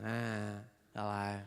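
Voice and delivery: low, drawn-out Aussie sound; Aussie hello. Shown as a demonstration. Comedian shows audience the Aussie sound of hello, mildly broad Australian. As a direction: lay on a touch of Australian. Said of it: "It's that Aussie sound".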